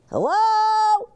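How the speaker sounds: high, loud hello; holler. A narrator's reading. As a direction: call it out across the lot. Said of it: "Gus hollers".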